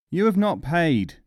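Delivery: merchant's loud call after a leaving customer; shouted. A narrator's reading. Merchant shouting at the mullah who walks off. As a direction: shout it across the shop. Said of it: "shouted the merchant".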